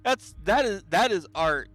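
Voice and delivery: high, loud laughing disbelief; cracking up at the face. Real, not acted. Laughing at a funny portrait.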